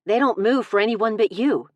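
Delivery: alarmed insistence; urgent insistence. Acted. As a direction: make her see it.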